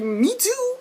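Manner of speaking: high, squealed exclamation; overdramatic agreement. Acted. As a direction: cry it out high, hamming up relatability. Echoing vulnerable line as fan.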